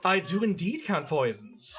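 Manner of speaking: slow, theatrical put-on accent; drawn-out accented alchemist voice; Suharto, put-on accent. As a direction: stretch it out in an affected accent. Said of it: "why he has this accent right now".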